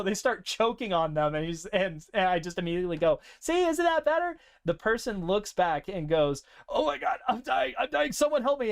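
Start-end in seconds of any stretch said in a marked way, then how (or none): 3.4-4.4 very high, sing-song fake kindness; high cheery voice after force-feeding cough drops; mock sweet. Hero after choking the bartender. 6.7-8.7 high, strained, hammed-up choking plea; high, pained cry from a choking bartender; mock panic. Choking bartender begs for help.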